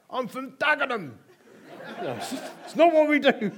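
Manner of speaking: laughing tough-guy joke. Real, not acted. Jokey tough-guy aside, laughing.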